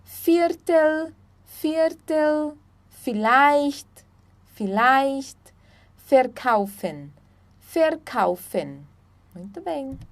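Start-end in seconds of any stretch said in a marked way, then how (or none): 0.0-8.9 "Viertel", "vielleicht", "verkaufen" beginning with F. Shown as a demonstration. Teacher explaining German V, F sound in "Viertel", "vielleicht", "verkaufen". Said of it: "sempre vai ter o som de F".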